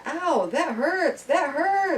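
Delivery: high, slow robotic toy voice; talking toy saying ow; computerized toy voice. Retold or imitated. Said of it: "it'll be like".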